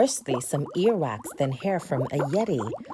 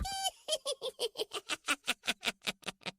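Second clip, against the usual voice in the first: high witch cackle; evil cackle; 10 dB quieter than usual. Acted.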